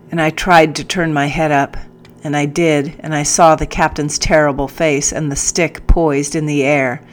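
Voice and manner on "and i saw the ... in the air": ordinary delivery, nothing marked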